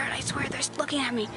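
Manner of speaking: fast nervous whisper. Real, not acted. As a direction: whisper it so they can't hear. Watched by people in truck.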